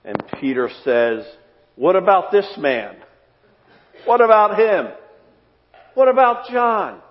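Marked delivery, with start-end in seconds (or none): 1.8-7.0 high, rising, insistent pleading; Peter's jealous whine. Acted. Peter to Jesus, pointing at John, high indignant whine. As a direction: push harder with each question, higher and louder.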